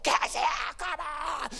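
high, loud cheering yell; shouting dad. Retold or imitated. Dad on the touchline urging his running daughter, shouting. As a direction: holler her on, pitched right up. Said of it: "start shouting".

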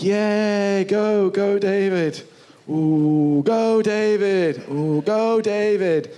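rooting for David from the ranks; cheering crowd. Retold or imitated. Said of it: "they were going like".

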